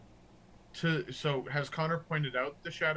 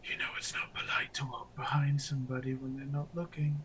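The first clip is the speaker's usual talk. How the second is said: whispered spell phrase. Acted. Caster to the one following him, whispered. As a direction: breath-only whisper into the victim's mind. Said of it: "he's going to whisper a phrase".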